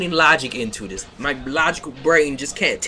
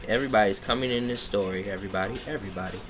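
exasperated aside; 5 semitones lower, 7 dB quieter, much faster than usual. Real, not acted. Exasperated aside about the story.

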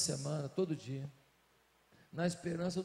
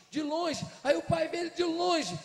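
higher, louder, calling out; building shout; 8 semitones higher, 4 dB louder than usual. Acted. Preacher stirring the congregation, shouting out. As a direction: lift the voice to the back row.

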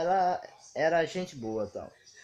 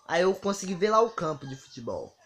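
ordinary talk, nothing marked

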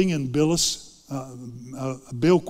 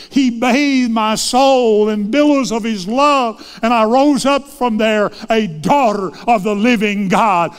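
loud, soaring preacher's voice held high; preaching climax; 6 semitones higher, 9 dB louder than usual. Acted. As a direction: lift the whole vision to full revival force. Sermon climax, retold vision.

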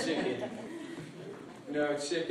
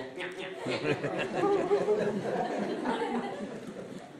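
hyena cackle; 3 dB quieter than usual. Acted. Cunning hyena laughs.

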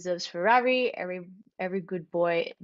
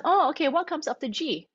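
higher-pitched, animated mock questioning; teacher prompting pupil; 8 semitones higher than usual. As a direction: act out a teacher quizzing a child. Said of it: "And then you can ask".

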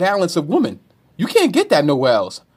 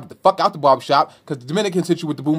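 normal talking voice

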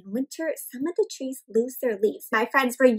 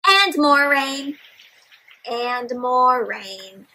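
storybook reading; much slower than usual. A narrator's reading. Teacher reads book aloud.

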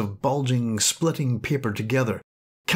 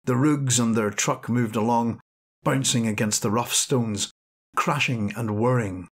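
ordinary delivery

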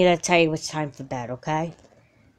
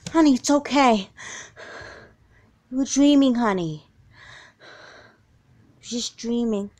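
soothing mother; 5 semitones higher than usual. Acted. Comforting after nightmare.

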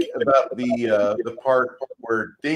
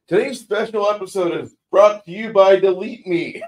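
announcer voice, higher and louder, laughing through it; jokey podcast ad read; mock ad read; 7 semitones higher, 4 dB louder than usual. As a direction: pitch it like a sponsor spot.

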